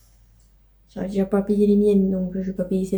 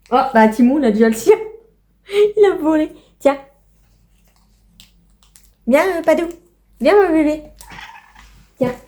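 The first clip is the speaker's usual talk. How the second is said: higher, coaxing voice for the pets, louder; pet talk; 3 semitones higher, 3 dB louder than usual. Real, not acted. Owner coaxes her pets to come get chicken treats, higher coaxing voice. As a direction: coax the animals over to their treats.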